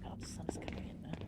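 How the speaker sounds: soft unvoiced whisper. Real, not acted. Noticing sunlight in the ruins, whispered. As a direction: hush it like you're sneaking. Hushed game exploration.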